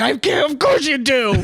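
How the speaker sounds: hammed-up comeback; mock retort. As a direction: scoff back in a lifted voice. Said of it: "And he's like".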